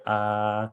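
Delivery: open-mouthed "Ah", deep; like at the dentist, "Ah". Shown as a demonstration. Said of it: "You say".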